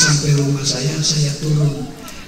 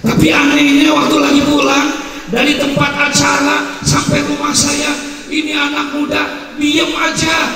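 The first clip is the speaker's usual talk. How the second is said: fervent sermon; 13 semitones higher than usual. A narrator's reading. Preacher telling the crowd of the silent young man, fervent. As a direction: lift it into full sermon voice.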